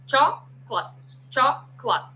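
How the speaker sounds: chocolate squeezed to two syllables, choc-late; English chocolate. Shown as a demonstration. Teacher showing students how chocolate is said. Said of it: "A palavra chocolate é".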